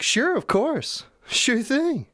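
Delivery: very high, slow put-on helper voice; squeaky, obliging bot impression; chirpy AI assistant. AI agreeing to make a bass beat, mock-chirpy. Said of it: "And it's like".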